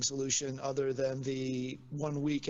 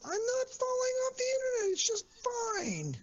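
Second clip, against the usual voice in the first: squeaky voice way up high; high-pitched diatribe. Real, not acted. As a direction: insist it's all fine in a squeaky high voice. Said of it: "you made your really high-pitched diatribe".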